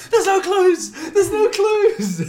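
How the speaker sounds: high, loud mock-desperate wail; hammed-up panic, very high; panicked shout. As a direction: yell like a trapped escape-room player. Trapped escape-room player acted.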